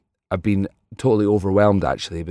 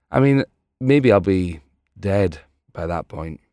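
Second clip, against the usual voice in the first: normal talking voice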